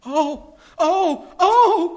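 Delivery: high, loud anguished oh; crying out in dismay. Acted. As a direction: girls find the table broken. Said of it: "cried the two girls".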